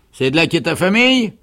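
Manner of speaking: loud question rising high; questioning sergeant. A narrator's reading. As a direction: ask it at full voice. Sergeant resumes interrogation. (question forte et montante du sergent)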